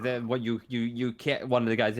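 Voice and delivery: ordinary talk, nothing marked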